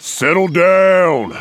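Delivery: high, slow order; commanding call. Acted. Gang leader halting a fight, commanding. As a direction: order the men to back off. Boss breaks up a brewing fight.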